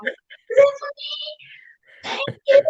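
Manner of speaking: high, breathy mock swoon at being served; hammed-up girlish gratitude. Mock swooning at dinner table.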